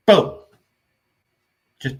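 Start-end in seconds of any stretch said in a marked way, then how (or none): 0.1-0.4 loud, high burst of a boom; sudden gunshot boom. Retold or imitated. Film reviewer acting out the soldier suddenly shooting, explosive boom. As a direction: punch it out like a gunshot.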